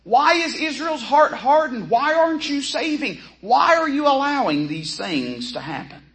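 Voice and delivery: a desperate believer begging God for answers, pitched high; crying out to God. Retold or imitated. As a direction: cry out to God like a bewildered believer. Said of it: "they're crying out to the Lord and saying".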